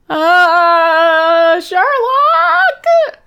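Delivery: very high, drawn-out call for help; mock-alarmed, sustained high yell. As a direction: holler up the house in a rising high voice. Joan calling Sherlock up, retold.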